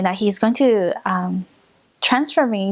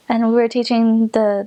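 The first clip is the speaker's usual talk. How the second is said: usual speaking voice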